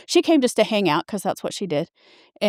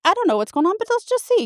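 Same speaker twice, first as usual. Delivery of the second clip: higher, casual doctor voice; doctor quoted; 12 semitones higher, much faster than usual. Retold or imitated. Doctor shrugging at the patient, high and casual. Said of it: "He's like".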